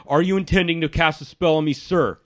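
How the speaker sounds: a hint of an officious guard. Guard warning the wizard about private residences, stiff. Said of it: "He's like".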